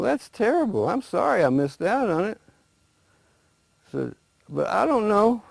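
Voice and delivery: a naive alligator, pitched high and eager; lightly voiced, wide-eyed alligator asking about trouble. Alligator asking the rabbit what trouble is, high and eager.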